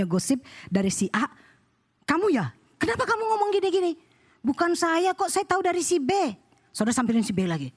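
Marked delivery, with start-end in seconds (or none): 2.0-3.9 high, quickening accusing question; mock confrontation. Churchgoer tackles a suspected gossiper, mock accusing. 4.4-6.1 tag. Acted. Gossiper denies and blames B.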